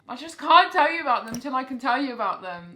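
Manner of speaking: loud, lively Australian accent; a loose Australian accent, almost bursting. Real, not acted. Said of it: "I can't stop doing an Australian accent".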